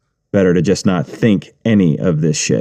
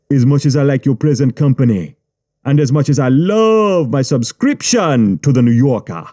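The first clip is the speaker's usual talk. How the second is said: Jamaican gangster; 4 semitones higher than usual. Acted. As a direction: tease him with what you know. Gangster warning.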